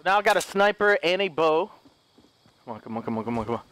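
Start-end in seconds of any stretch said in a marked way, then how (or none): 2.6-3.6 low, soft, repeated plea; hushed urging. Real, not acted. Gamer willing things along, hushed urging. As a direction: urge it quickly under your breath.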